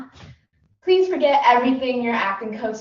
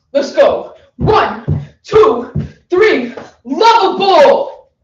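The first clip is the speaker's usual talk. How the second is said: loud counting, shout lovable; shouted workout count; 5 semitones higher, 8 dB louder, slower than usual. Acted. Actor counts jumping jacks, shouting. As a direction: count off like a drill sergeant.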